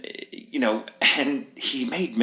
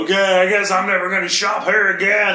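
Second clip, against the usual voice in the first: whiny threat; 7 semitones higher, 5 dB louder than usual. Retold or imitated. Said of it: "he was being an asshole".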